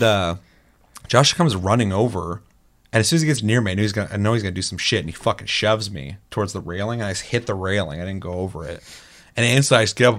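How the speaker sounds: normal talking voice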